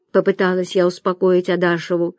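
plain reading voice

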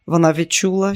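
ordinary delivery, nothing marked

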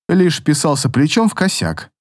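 usual speaking voice

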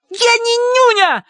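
put-on high, slow furious child's scream; furious shout. A narrator's reading. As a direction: scream it in rage. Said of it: "с такой яростью закричала Агнес".

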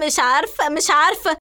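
high, tearful, hysterical outburst; acted nervous breakdown, pitched high; frantic agitation. A narrator's reading. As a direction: repeat it desperately, then rush on. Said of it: "وهي تشد وتقطع شعرها بأيديها بعصبية".

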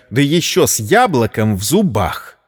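usual speaking voice

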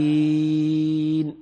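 نستعين with the ii held longest, very slow and even; the longest chanted madd on نستعين; six-count madd. Shown as a demonstration. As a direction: stretch نستعين for six full beats. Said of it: "ست حركات بالسكون المحض".

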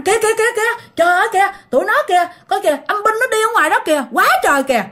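high-pitched frantic call; alarmed pointing out. Retold or imitated. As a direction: urgently warn of spirits outside. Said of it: "nói rằng". (giọng hoảng hốt kêu lên)

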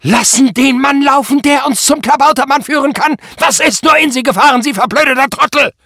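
high, loud, relentless furious tirade; screaming rage. A narrator's reading. Enraged boss berates his officer over the released man, screaming. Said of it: "schrie Prall wie am Spieß".

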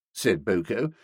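ordinary delivery, nothing marked

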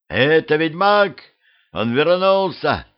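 high, slow excited call; boy shouting. A narrator's reading. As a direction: announce the witcher to the crowd. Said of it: "внезапно закричал пацан".

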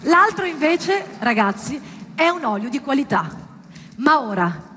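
ordinary delivery

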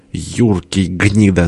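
ordinary delivery, nothing marked

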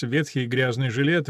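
plain reading voice

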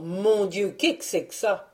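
a touch of alarm, the donkey yelping; startled donkey cries out in mild dismay; mild startled cry; donkey's startled cry. Acted. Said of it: "s'écriant".

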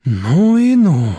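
slow, drawn-out wonder; amazed. Acted. Nadezhda watching the husband storm out, amazed. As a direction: stretch it out in amazement.

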